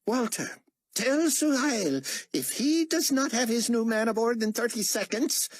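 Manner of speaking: high, imperious bark from a potentate; barked order. A narrator's reading. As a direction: bark an ultimatum at your underling, pitched well up. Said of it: "Carpathia barked".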